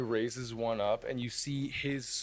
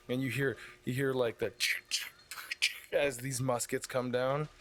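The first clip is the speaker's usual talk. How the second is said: usual speaking voice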